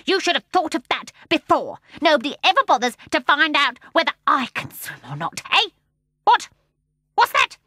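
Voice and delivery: high, loud complaining voice; grumble, then turn aside sharply; grumbling seal. A narrator's reading. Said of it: "said the seal".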